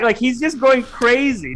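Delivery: ordinary talk, nothing marked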